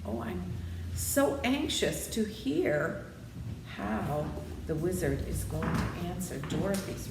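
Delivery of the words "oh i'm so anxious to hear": ordinary delivery, nothing marked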